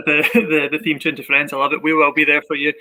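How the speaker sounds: normal talking voice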